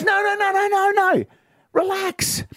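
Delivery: high-pitched husband protesting innocence; hammed-up, high "no, no" protest; frantic placating. Drunk husband placates furious wife.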